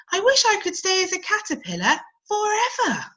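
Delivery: dreaming of staying small; wistful caterpillar. Acted. Cody wishes to stay.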